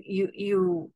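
normal talking voice

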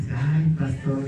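a low, put-on voice of a member calling out to the pastor; lightly voiced churchgoer, half-joking; acted church member. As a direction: drop into a different voice for the complaining congregant. Congregant voiced in acted scene.

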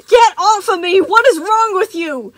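very high, loud panicked scream; terrified cry. Acted. Gregory screaming at Moon while trapped in wires. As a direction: thrash and scream in terror. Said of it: "Gregory cried".

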